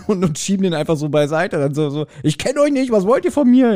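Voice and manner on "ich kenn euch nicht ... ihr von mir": high, whiny protest; high indignant voice. Retold or imitated. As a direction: squeak out a high indignant complaint. Retelling Richard's indignant reply.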